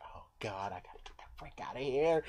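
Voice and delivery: hushed frightened whisper; terrified whispering; panicked whisper. Pretend. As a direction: whisper so the leopard won't hear. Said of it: "I'm like".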